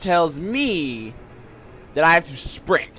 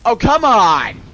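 loud, high protest at the game; exasperated outburst; 8 semitones higher, 8 dB louder, slower than usual. Real, not acted. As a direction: cry out in frustration. Reacts to game mishap.

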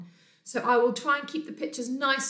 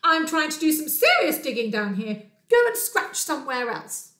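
loud, higher-pitched telling-off; indignant mole; 4 semitones higher, 7 dB louder than usual. Acted. Mole busy digging scolds Bear, indignant and loud. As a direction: scold the intruder with emphatic stresses. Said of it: "cried a mole".

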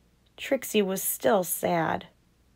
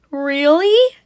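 high, loud surprised "Really?"; mommy asking; 8 semitones higher, 8 dB louder than usual. Acted. Trixie's mommy amazed at her offer, high loud question. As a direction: pop up in amazement. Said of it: "asked Trixie's mommy".

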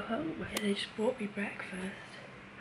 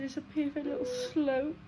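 quoting, tearful; 6 semitones higher than usual. Retold or imitated. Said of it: "you're gonna make me cry".

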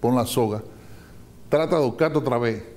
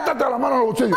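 angry shout; 10 semitones higher, faster than usual. Acted. Father yelling at his son to put his hands in his pockets, shouting. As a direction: lose patience and yell it at him. Father repeats order, escalating.

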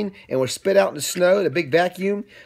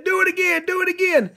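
high, eager squeaky plea; excited high voice; 13 semitones higher than usual. Acted. Sneetches begging to ride again, high and eager. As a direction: beg for another go. Characters want another ride.

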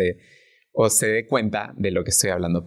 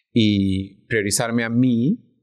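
slow, every word given full weight; weighty emphasis; much slower than usual. Real, not acted. Guest stressing her own priority, slowly and weighty. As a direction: lean hard on priorizarme a mí. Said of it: "con todo el peso de esa palabra".

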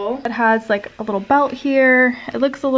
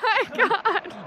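high, slow shocked cry; stunned, stretched-out disbelief; 11 semitones higher, much slower than usual. Real, not acted. Friend jumps toward water.

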